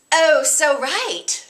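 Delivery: put-on high, very slow valley girl; valley girl accent. Shown as a demonstration. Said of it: "with that little bit of an accent there".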